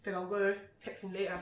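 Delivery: ordinary talk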